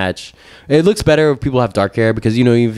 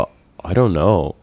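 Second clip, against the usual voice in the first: drawn-out, lower, imitated uncertainty; clueless hesitation; 4 semitones lower, much slower than usual. Young clerk answering a patient's odd request, clueless and hesitant. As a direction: imitate a clueless kid stalling, words stretched.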